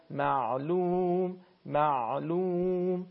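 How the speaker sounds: معلوم said slowly, the silent ع lightly drawn out; ع with sukun. Shown as a demonstration. Teacher demonstrating a silent ع for the caller: slow and drawn out. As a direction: stretch the ع in معلوم gently.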